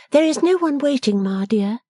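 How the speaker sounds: put-on reply plainly in a high, young woman's voice; Bella's voice. A narrator's reading.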